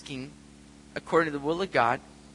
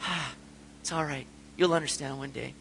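soothing; 3 semitones lower, 8 dB quieter than usual. Retold or imitated. Father comforting hurt daughter.

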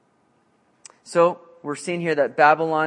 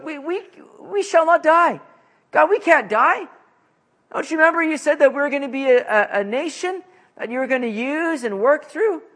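much higher, louder desperate pleading; pleading with God; 8 semitones higher, 4 dB louder than usual. Acted. Habakkuk pleading with the Lord, high and desperate. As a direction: beg God not to let them die. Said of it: "He's pleading with the Lord".

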